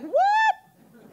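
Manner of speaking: high, loud shriek of disbelief; comic high-pitched shocked yelp. Retold or imitated. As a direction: re-enact a stunned outburst, hammed up. Retells shock at not graduating.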